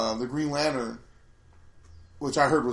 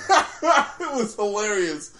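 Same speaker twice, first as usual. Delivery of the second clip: laughing; 7 semitones higher, 5 dB louder, much slower than usual. Real, not acted. Laughing over cartoon story.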